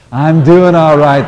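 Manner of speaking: said like you mean it. Shown as a demonstration. Minister urging the congregation to mean it, loud and slow. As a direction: proclaim it with full conviction. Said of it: "say it like you mean it".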